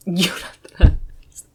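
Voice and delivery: breathy words through giggles; laughing whisper. Real, not acted. Streamer laughing at the game character, whispered. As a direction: whisper it while cracking up.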